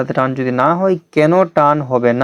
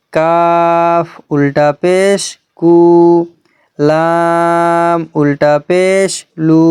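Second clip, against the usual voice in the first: drawn-out recitation drill; much slower than usual. Shown as a demonstration. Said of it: "চলুন আমরা পুরোটা পড়ে নিই".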